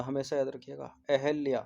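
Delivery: ordinary talk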